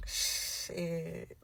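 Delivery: low, soft, long drawn-out word; hushed "shhhit", stretched out; stunned mock reaction. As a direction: imitate his dawning dismay, quietly. His stunned reaction to pregnancy news.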